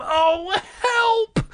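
high, small frightened cry; tiny frightened voice. Acted. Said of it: "my tiny, frightened voice".

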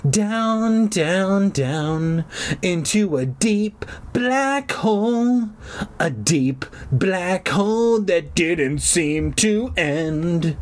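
slow, high, long-held words; drawn-out falling. A narrator's reading. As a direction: stretch each word like an endless drop. Narrates an endless fall, stretched.

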